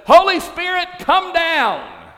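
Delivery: shouted call to the rafters. Retold or imitated. Pastor calls up to the rafters, loud and high. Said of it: "and he says".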